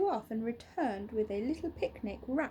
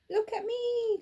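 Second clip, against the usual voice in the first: put-on much higher, thrilled child calling out; excited cry; 11 semitones higher, 5 dB louder than usual. Little Bear calling to the toys, excited and high. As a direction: call to friends below in delight. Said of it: "cried Little Bear".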